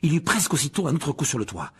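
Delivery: usual speaking voice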